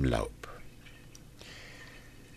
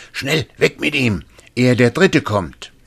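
hurried higher cry of the little man; urgent call; 8 semitones higher, 4 dB louder than usual. Acted. The little man urges the youth to hide the giant, urgent call. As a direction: hurry him along, hushed urgency.

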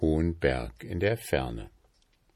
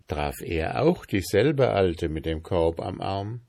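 ordinary delivery, nothing marked

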